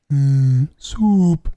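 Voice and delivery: loud vocal sound effect; imitated gravity gun. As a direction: imitate a gun's hum and thwoop. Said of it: "it goes".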